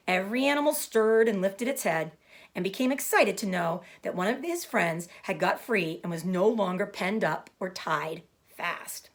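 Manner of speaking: ordinary delivery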